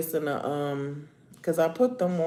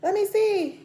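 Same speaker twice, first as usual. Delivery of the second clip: high, fast, gushing admirer voice; gushing friend; faster than usual. Retold or imitated. Mocking a friend who admires her nails, high and gushing. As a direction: squeal over the nails like an excited friend. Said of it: "somebody be like".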